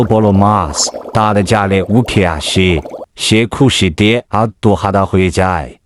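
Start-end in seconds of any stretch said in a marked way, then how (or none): none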